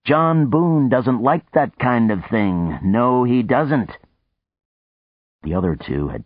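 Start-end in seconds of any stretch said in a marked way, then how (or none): 0.0-4.0 slow, high, loud mock self-praise; mocking third person. Boone mocking himself in third person to Houston, slow high jeer. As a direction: savor each word, slow and loud. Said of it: "laughed and said".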